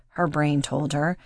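ordinary delivery

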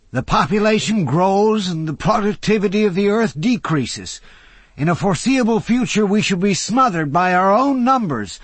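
put-on high, loud grand old man; a lightly theatrical old philosopher lecturing; old orator. A narrator's reading. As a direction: hold forth with gleeful doom. Old Jay's voice.

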